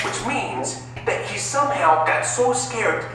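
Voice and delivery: ordinary talk, nothing marked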